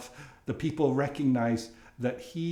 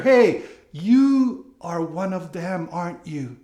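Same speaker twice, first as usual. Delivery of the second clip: put-on accusing servant girl; 7 dB louder than usual. Servant girl challenging Peter by the fire, mild loud accusation.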